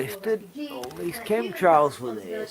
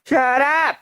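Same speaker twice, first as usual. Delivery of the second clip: shout; 7 semitones higher than usual. Real, not acted. Man shouting at crowing rooster, annoyed yell. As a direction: yell it across the yard.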